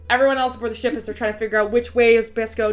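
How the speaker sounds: ordinary talk, nothing marked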